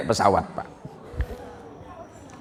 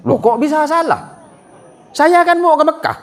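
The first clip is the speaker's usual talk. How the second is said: indignant protest; 10 semitones higher than usual. Acted. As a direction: protest like you've been wronged. Passenger protests to the stewardess.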